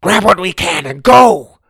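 loud, high shouted command; urgent order. Acted. Dragon man yelling at the others to flee, loud urgent order.

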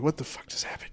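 whispered disbelief; stunned whisper. Retold or imitated. Walking out after sex, a dazed whisper to himself.

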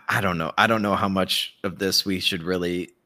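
ordinary talk, nothing marked

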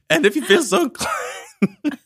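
high squeaky cracking replay. Retold or imitated. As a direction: yodel the crack on purpose. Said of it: "I said".

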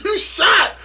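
high, stunned "you shot?"; shocked disbelief. Retold or imitated. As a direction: say it like you can't believe your ears. Friend says he is shot.

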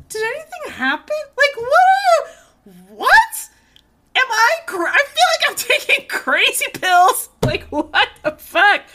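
high, loud incredulous ranting; half-laughing, I'm taking crazy pills; loud exasperated venting with a laugh; exasperated disbelief. Real, not acted. Venting disbelief at mixed messages.

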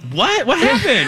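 high, laughing clueless "what?"; hammed-up baffled question; Lex. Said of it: "And Lex".

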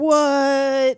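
mock surprise. Acted shocked reaction.

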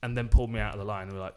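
ordinary talk, nothing marked

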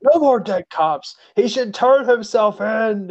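high, hammed-up line reading; overdramatic extra. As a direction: deliver it like a bad extra. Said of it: "a bad line reading from an extra".

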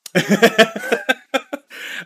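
high, loud laugh breaking out; real laughter, rising; burst of laughter. Real, not acted. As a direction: crack up laughing.

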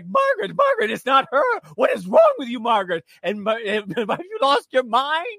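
high, loud mock shouting at Margaret; alarmed guests. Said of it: "And they're like".

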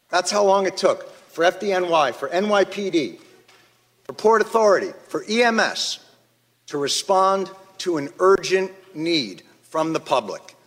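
ordinary talk

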